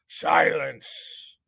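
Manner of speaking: commanding call for silence; imperious head mouse demanding silence; stern head mouse. Acted. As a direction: bark it down at the room like a judge. Head mouse silences trial crowd.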